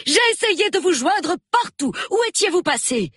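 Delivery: high, loud, frantic cry of worry; breathless agitation. A narrator's reading. Mrs Bantry greets her late-arriving friend, breathless and agitated. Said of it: "d'une voix haletante".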